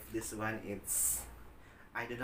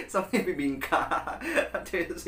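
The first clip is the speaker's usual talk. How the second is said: high, loud laugh into words; 8 semitones higher, 4 dB louder than usual. Real, not acted. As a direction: burst out laughing mid-sentence. Laughing at the bibingka comparison.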